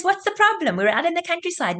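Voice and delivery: high-pitched, dismissive husband's retort; acted protest. Husband argues back in acted quarrel.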